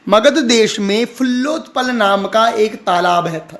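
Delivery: ordinary talk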